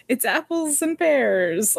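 put-on a lightly done Cockney accent, a bit slow; mock Cockney. Hardison mixing up Southern and Cockney, in a mock Cockney accent. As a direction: slip Cockney rhyming slang into the fake Southern act. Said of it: "like, Cockney".

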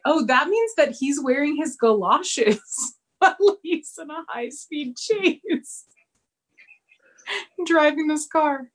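high, giggly retelling; laughing quote. Retold or imitated. Host retelling the DVD commentary joke, laughing.

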